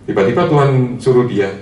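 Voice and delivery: ordinary talk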